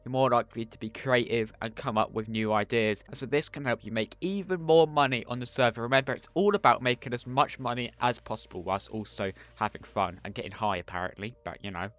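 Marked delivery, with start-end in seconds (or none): none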